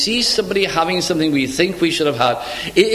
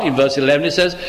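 ordinary talk, nothing marked

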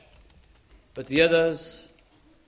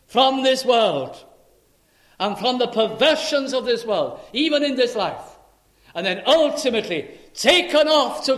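loud, high climactic preaching; high, loud sermon climax; fiery preaching; 7 semitones higher, 8 dB louder than usual. Acted. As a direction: proclaim it with full force. Preaching at full force.

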